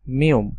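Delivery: মিউম said as Korean "mi-eum"; teacher naming the letter মিউম; Korean letter name. Shown as a demonstration. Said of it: "যার নাম হবে হলো".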